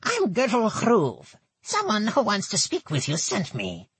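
put-on high, nasal old goblin woman; thick nasal goblin voice. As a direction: pinch it through the nose, goblin. Gertel continues.

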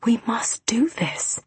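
slow, high whisper; almost inaudible. A narrator's reading. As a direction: whisper it slowly, urging him on. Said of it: "I said almost inaudibly".

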